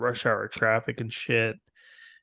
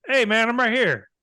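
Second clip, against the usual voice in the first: mock cheery protest; 10 semitones higher, 4 dB louder than usual. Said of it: "I'm like".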